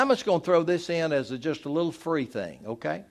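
usual speaking voice

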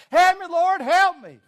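very high, loud comic pleading; mock desperate scream. Man on cliff begs the Lord, high mock scream. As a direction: squeal for rescue at the top of the voice. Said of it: "And he screamed".

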